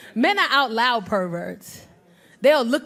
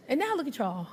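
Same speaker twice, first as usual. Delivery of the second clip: ordinary talk, nothing marked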